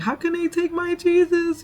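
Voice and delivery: high, wailing mock sob; high-pitched imitated crying plea. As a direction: imitate a grieving woman crying out. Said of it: "crying like".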